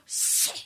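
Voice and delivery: long unvoiced shh; shushing hiss. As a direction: hush like a silent hall. Audience falling silent.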